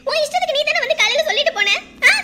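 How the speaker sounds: angry, loud phone outburst. Acted. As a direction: lash out loudly at your mother. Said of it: "கோவப்படாதம்மா". (கோபமாக சத்தமாக)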